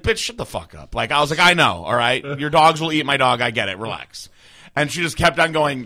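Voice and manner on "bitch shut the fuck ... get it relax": angry retort. Retold or imitated. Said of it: "And I was like".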